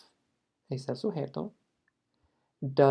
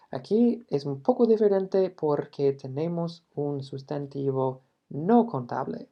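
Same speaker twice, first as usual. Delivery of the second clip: ordinary talk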